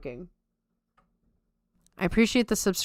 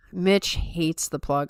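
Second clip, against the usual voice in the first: ordinary talk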